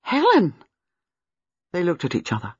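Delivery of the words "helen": sharp cry. Acted. Gwenda recognising the name on the certificate, sharp cry. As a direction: blurt the name in shock. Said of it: "Gwenda cried out sharply".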